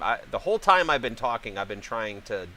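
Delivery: ordinary talk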